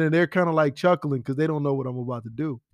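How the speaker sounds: ordinary talk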